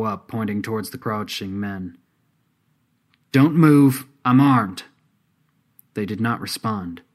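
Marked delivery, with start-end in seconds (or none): 3.3-4.8 loud, raised warning; warning shout. A narrator's reading. Artemis warns the crouching men she is armed, shouting. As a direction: call out a firm warning, much louder. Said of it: "this time directing her shout".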